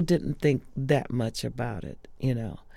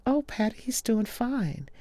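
reassuring; 10 semitones higher than usual. Retold or imitated. Reassuring Patti, high pitch.

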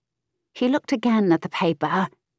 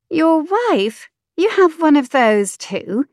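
put-on higher, slower, pert young woman; saucy mistress voice, high; 9 semitones higher, 4 dB louder, slower than usual. Mistress snapping at the earl, pert high voice. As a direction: tease him back, pitched up and slow.

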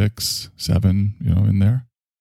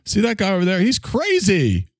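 mocking judgmental gossip; 8 semitones higher than usual. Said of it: "And we like to go".

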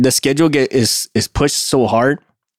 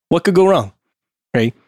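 usual speaking voice

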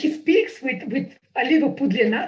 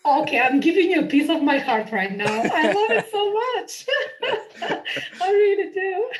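gushing through laughter, pitch climbing; laughing delight; 6 semitones higher than usual. Real, not acted. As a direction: laugh as you gush. Delighted by the Scottish accent, laughing.

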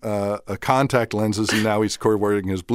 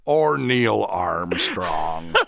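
slow, drawn-out mock Neil Armstrong voice; a light parody of a famous voice; Neil Armstrong impression; 4 semitones lower, much slower than usual. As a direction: stretch each word like a stiff astronaut. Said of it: "And then he goes".

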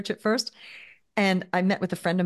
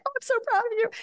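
very high-pitched, gushing pride; tearfully proud; much faster than usual. Retold or imitated. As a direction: re-enact proud happy tears. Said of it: "we're crying together".

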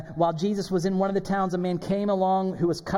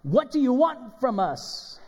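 higher, retold demon's yell; crying out; 7 semitones higher, slower than usual. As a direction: cry out like a demon confronted. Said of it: "cried out at the top of his voice".

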